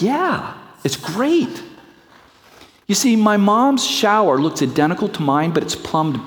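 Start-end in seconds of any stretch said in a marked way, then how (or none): 0.0-1.6 put-on high, happy teenage voice; son's voice. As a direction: play the son, pleased. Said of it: "He's like".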